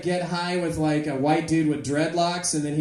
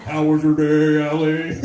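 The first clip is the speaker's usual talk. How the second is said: loud robotic monotone; talking gadget voice; 3 semitones lower, 5 dB louder than usual. Acted. Vibrating gadget chats to its owner, robotic monotone. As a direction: drone it loudly on one note. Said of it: "Maybe it'll talk".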